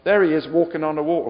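ordinary talk, nothing marked

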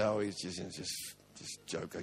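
dropping to a soft, half-voiced sneer; mock dismissive mutter. Critics sneering that he's just a joker, quiet mock scorn. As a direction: brush him off under your breath. Mocking critics who write him off.

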